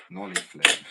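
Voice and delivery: high, loud shout; sudden high-pitched holler; trick call-out. Real, not acted. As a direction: yell the trick name out loud. Calling out a trick attempt.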